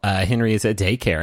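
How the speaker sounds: ordinary delivery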